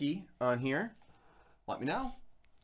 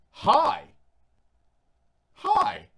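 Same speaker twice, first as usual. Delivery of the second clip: mimicked dad; 13 semitones higher, 7 dB louder, slower than usual. Dad's voice mimicked on phone.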